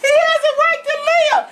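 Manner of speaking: high pleading voice with laughter; laughing mimicry of a pleading girl; imitating Cindy. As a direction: beg high, half laughing. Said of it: "And she said".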